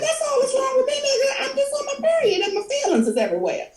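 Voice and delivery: very high, hammed-up tearful squeal; dramatic mock crying. Host explaining her tears to her friend, dramatic mock crying. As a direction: overplay the meltdown in a high wail. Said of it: "I'm dramatic, okay?".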